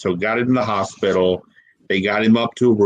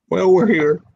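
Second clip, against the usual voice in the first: higher and louder as the voice cracks; voice cracking; 8 semitones higher, 3 dB louder than usual. Real, not acted. Answering his dying brother, voice cracking with real grief. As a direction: let the words break on the edge of tears. Emotional retelling at deathbed.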